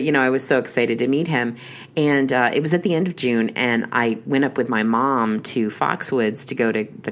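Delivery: ordinary talk, nothing marked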